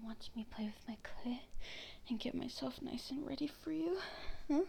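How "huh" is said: ordinary delivery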